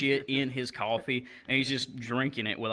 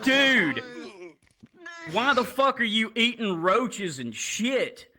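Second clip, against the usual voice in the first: disgusted outburst; 7 semitones higher, slower than usual. Retold or imitated. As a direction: confront him in disgust. Confronting patient eating roaches.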